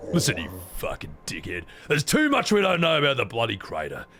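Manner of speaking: put-on irritable character voice; gruff, foul-mouthed game character. Streamer voicing a reply about the crater, irritable character voice. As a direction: scold like a crude, impatient comrade.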